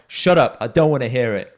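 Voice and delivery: loud, firm telling-off; acted-out forceful dismissal; retold angry command. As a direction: imitate a man shutting his mate up. Acting out Gary silencing his mate.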